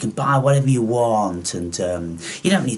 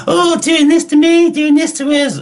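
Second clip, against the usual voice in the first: very high, louder mock whining voice; hammed-up high-pitched mockery; mocking whine; 6 dB louder than usual. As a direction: squeal it like a spoilt complainer. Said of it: "and then they're like".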